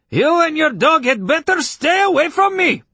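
high, loud angry warning; spooked neighbor's irate shout; calling after them. A narrator's reading. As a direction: yell a shrill threat after someone leaving. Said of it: "called after us".

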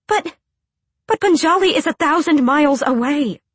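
high, loud stammering protest; upset protest. A narrator's reading. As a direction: push back in rising alarm. She protests his leaving.

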